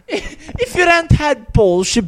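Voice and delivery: put-on high, loud, drawn-out British accent; hammed-up Brit delivering a proverb slowly; British man impression. Remembering the video's line, loud British impression. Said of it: "He's like".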